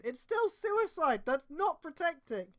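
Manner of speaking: slow, high stern rebuke; harsh reply. Acted. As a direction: cut him down firmly. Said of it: "a harsher reply of her own".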